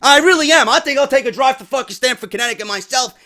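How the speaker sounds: ordinary delivery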